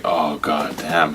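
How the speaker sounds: slow, low, awestruck murmur; hushed stunned reaction; acted awe. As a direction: let it out slowly, dumbstruck by the size. Acting awed reaction to huge monster.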